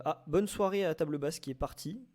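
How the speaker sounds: ordinary talk, nothing marked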